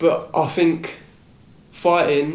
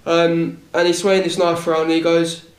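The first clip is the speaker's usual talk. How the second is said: usual speaking voice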